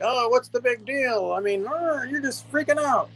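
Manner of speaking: high, put-on voice of dismissive relatives; mock dismissive. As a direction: mimic them brushing it off, pitched well up. Mocking retold family's dismissive reaction.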